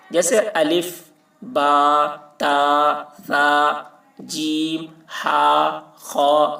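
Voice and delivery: each Arabic letter held long and level, one by one; معروف pronunciation. Shown as a demonstration. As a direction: recite الف، با، تا with clear long ā and ī vowels. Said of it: "سب کو معروف پڑھ رہے ہیں". (معروف تلفظ، ہر حرف کھینچ کر)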